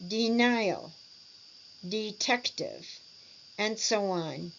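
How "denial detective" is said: "Denial, detective" stressed on the middle syllable. Shown as a demonstration. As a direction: lean on the middle of "Denial" and "detective", then fall. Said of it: "with the stress in the middle".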